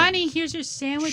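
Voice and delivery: high timid housewife voice; meek sitcom wife. Acted. Bit playing a submissive wife.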